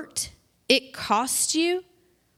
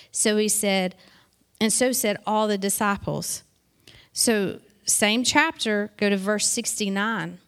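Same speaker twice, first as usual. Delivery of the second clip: ordinary talk, nothing marked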